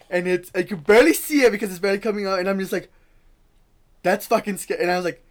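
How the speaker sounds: high and loud, panicked; frightened retelling, near crying; scared. Retold or imitated. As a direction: yelp it out in fright. Terrified memory of crying woman.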